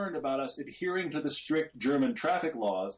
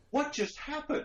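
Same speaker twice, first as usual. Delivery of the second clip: much higher, stunned; mock disbelief; 13 semitones higher than usual. Recalling Tom's casual speed message, stunned disbelief.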